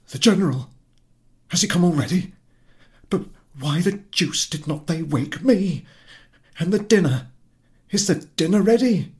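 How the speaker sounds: high, loud alarmed questions; the news jolts him awake; husband suddenly panicking about dinner; startled husband. Acted.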